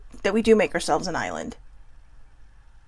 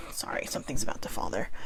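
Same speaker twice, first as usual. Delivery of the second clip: quick hushed whisper, much softer and lower; whispered aside; 11 semitones lower, 11 dB quieter, faster than usual. Real, not acted. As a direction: drop to a whisper for a side remark. Quick aside mid-story about object.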